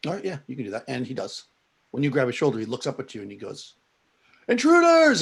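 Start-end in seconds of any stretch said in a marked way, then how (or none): 4.5-5.2 high, full-volume shout of alarm; loud yell. Acted. Woken guard yells at the intruder grabbing his shoulder, a loud high shout. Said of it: "he yells really loud".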